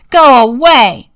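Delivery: loud, slow angry shout. A narrator's reading. Old man shouting at the squirrels to go away. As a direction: yell it out the window. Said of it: "shouted the old man".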